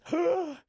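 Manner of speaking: sudden high gasp; sharp gasp. Real, not acted. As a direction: gasp in surprise. Surprise at stream of mids.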